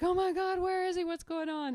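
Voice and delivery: high, loud panicked yelp; freaked-out cry. Retold or imitated. Convict finding his friend gone, panicked high cry. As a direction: squeal it like a terrified convict. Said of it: "he freaks out".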